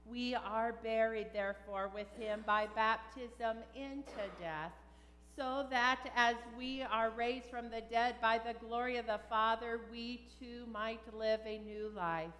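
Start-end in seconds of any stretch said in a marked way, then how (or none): none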